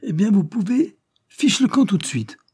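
high, very emphatic angry order; indignant shout. A narrator's reading. Boss throwing out his rebellious men, indignant shout. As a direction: bellow the dismissal at a high pitch. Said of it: "cria Pied-de-Bœuf en se dressant".

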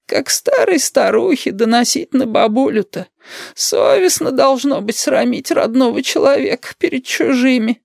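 put-on very high, slow old-woman voice; high, drawn-out granny scolding; aggrieved grandma. A narrator's reading. Grandmother's reproach in story.

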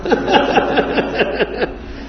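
long, rapid peal of laughter. Real, not acted. Teacher amused by a listener, long laughter. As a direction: keep laughing in quick bursts.

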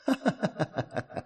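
soft high mocking laugh; girlish mocking giggle. A narrator's reading. Girl laughs at falling Will, soft mocking giggle. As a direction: giggle at him as he drops. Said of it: "когда она начала смеяться".

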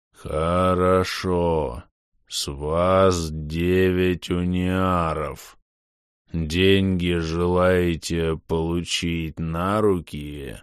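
very slow, eerie distorted voice. Acted. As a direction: eldritch horror, drawn out slowly. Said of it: "жутко искажённый голос".

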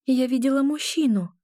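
high-pitched, understated fear; a touch of frightened tension; fearful. A narrator's reading. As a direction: confess it while shrinking back. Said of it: "съёжившись от страха".

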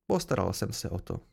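low, brief, closed answer; curt. A narrator's reading. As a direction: shut the question down briefly. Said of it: "odpověděl jsem stručně".

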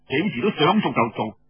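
ordinary delivery, nothing marked

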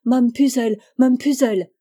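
anguished cry of pain. Acted. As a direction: call out in pain and distress. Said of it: "tordait à présent ses mains et criait". (cri de douleur)

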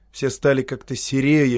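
ordinary delivery

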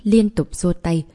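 usual speaking voice

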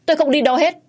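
high, loud refusal; outraged yell, digging in; defiant shout. A narrator's reading. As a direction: refuse at the top of your pitch. Wife defiantly refuses to leave.

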